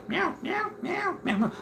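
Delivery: very high, soft meowing; cat meows. Pretend. Saying goodbye to viewers with tiny high cat meows. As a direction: mew like a tiny kitten.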